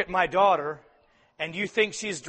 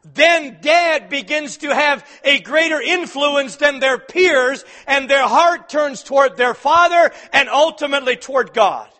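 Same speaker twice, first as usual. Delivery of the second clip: sermon delivered at full voice; full-force preaching; 9 semitones higher, 11 dB louder than usual. Acted. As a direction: preach it out to the congregation.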